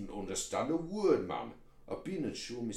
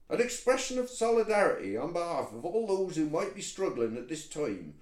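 loud, raised West Country proclamation; megaphone-loud; 3 semitones higher, 5 dB louder than usual. Acted. Toad announces that an Allocat has been kidnapped, loud proclamation. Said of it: "Would you like a megaphone".

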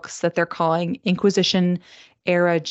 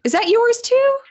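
very high, louder startled question; astonished; 5 dB louder than usual. Real, not acted. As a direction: squeal it in disbelief. Discovers they chose the same book.

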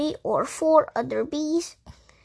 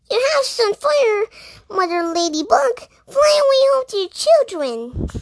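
much higher and louder, urgent shooing; high, loud little mouse; 10 semitones higher, 6 dB louder than usual. A narrator's reading. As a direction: shout the old rhyme at her, high and shrill. Mouse shoos away the ladybird.

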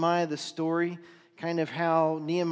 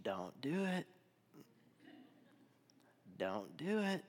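hushed, soft warning from within; small inner voice; 9 dB quieter than usual. Acted. Preacher voices the small interior voice for the congregation, hushed. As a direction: imitate a quiet voice of conscience, much softer. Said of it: "small interior voice whisper to you".

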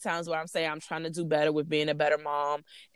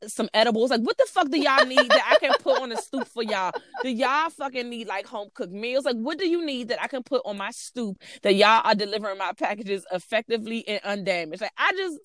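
exasperated rant, pitched up; worked up; 6 semitones higher than usual. Real, not acted. Customer demanding careful package delivery, worked-up exasperation. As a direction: get more and more worked up, pleading in disbelief. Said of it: "y'all getting me worked up again".

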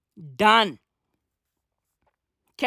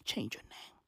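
whispered aside; 8 semitones lower, 20 dB quieter than usual. Acted. Aside to Aung San Suu Kyi.

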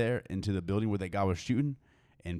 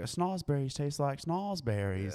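movie quote, slow; 5 semitones higher, 4 dB quieter, slower than usual. Retold or imitated.

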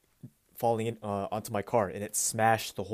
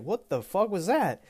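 high startled neighbor voice; a neighbor startled by a crash; 9 semitones higher than usual. Retold or imitated. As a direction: play the shocked neighbor, pitched up. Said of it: "she was like".

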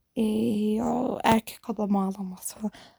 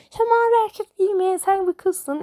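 much higher, mock-scolding voice; mocking others; 7 semitones higher than usual. Imitating people who told her blue is for boys, mock high scolding. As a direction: parrot the naggers in a high teasing voice. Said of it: "şey diyorlardı".

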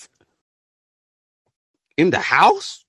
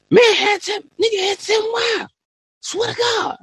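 incredulous high shout. Real, not acted. Outraged retelling of the video.

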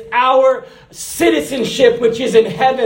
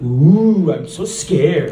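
mock fear; 4 semitones lower, slower than usual. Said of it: "you're like".

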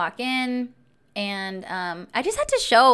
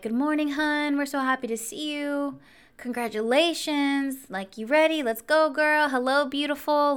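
high, sweet small-town greeter voice; friendly auntie; 6 semitones higher than usual. Retold or imitated. Clinic staff greeting her like aunties, high friendly imitation. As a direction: imitate doting aunties welcoming someone in. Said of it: "everybody was my auntie".

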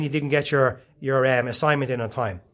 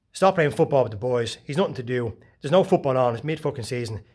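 normal talking voice

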